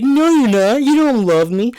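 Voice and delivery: high, loud whiny brat voice; mocking bratty kid. Mocking Henry snapping at his mother, high whiny brat voice. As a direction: sneer it like a spoiled teen.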